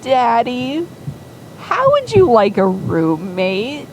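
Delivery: saccharine sweet. Retold or imitated. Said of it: "completely saccharine and sweet".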